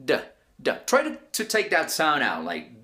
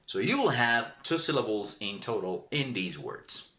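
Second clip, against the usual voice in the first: ordinary talk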